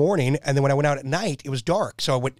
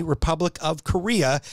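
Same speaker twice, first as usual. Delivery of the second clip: ordinary delivery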